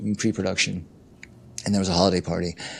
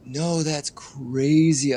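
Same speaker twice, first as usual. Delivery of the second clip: high, slow protest; drawn-out disbelieving no; 6 semitones higher, much slower than usual. Retold or imitated. Retold woman refusing.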